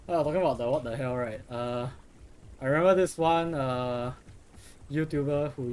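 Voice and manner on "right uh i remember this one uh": usual speaking voice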